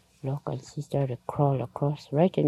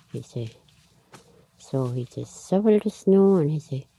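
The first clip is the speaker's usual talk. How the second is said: plain reading voice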